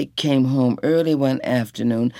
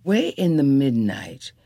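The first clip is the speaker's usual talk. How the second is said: plain reading voice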